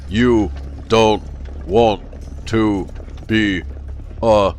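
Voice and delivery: sinister policeman warning the madman off, monotone; death-rattle constable. Acted. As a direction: speak like a ventriloquist's dummy. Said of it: "voice like a prolonged death rattle".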